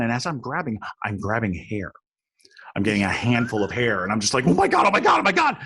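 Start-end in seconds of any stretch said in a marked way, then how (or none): 4.5-5.6 high, loud repeated panicked cry; panicked shriek. Retold or imitated. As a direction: shriek it over and over. Grabbing hair in the dark.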